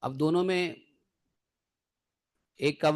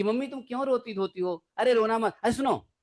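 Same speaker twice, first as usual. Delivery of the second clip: higher, mock-scolding daughter; lightly voiced daughter brushing off mom's tears; a hint of an impatient daughter; teasing daughter; 6 semitones higher than usual. Daughter scolds mother for crying.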